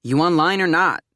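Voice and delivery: loud, impatient clerk call; booming. Acted. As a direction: boom it across the counter. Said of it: "A voice booms".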